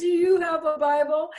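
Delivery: mother's question through laughter; laughing. Retold or imitated. Daughter retelling her mother's question to a repairman, laughing. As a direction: laugh while asking it.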